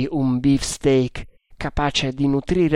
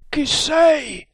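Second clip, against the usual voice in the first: muffled voice. A narrator's reading. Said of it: "chiesero con voce soffocata".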